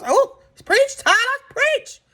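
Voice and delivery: church shout-out. Retold or imitated. Church call-out to the speech.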